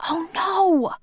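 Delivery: high, dismayed cry. A narrator's reading. Olivia hearing police at the door, high dismayed cry. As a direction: yelp it high in alarm. Caught half-dressed, police arriving.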